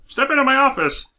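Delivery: high, put-on boss voice; mock boss voice. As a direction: play a smug boss. Boss character voice.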